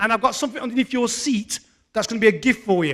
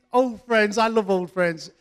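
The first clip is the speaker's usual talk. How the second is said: ordinary talk, nothing marked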